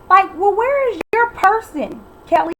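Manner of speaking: high, loud and slowed, exasperated; indignant outburst. Real, not acted. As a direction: call her out in disbelief.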